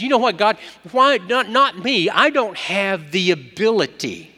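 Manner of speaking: high, loud desperate protest; pleading protest. Acted. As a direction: cry out that you can't do it. Moses protesting to God.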